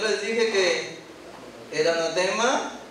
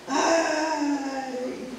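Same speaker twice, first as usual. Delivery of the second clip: quejido agudo de dolor; un ay lastimero, más agudo; mock wail; 7 semitones higher, 3 dB quieter than usual. El pueblo derrotado vuelve de Hai. (el pueblo derrotado se lamenta, ay agudo)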